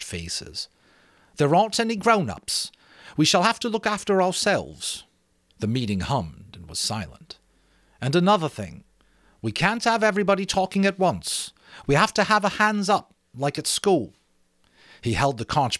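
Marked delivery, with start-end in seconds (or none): 1.4-5.0 put-on higher, louder boy leader; Ralph. Ralph speaking to the meeting. 8.0-14.1 put-on higher, louder boy leader; Ralph. Ralph setting rules.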